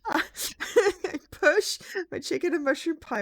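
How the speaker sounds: high, slow, loud, breaking into giggles; fighting laughter. Real, not acted. Reader retries misread line, fighting laughter. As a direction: struggle to read through real giggles.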